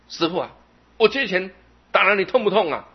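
high, loud jeering question; taunting challenge. Retold or imitated. Young man taunting the master after hitting him, loud. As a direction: goad him after the punch.

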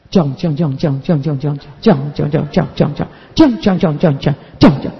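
low, murmured single sound repeated, imitating the student; mumbling one syllable. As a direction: mimic a shy student who can only get out one sound. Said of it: "都只有一个音". (模仿学生低声含糊地反复发同一个音)

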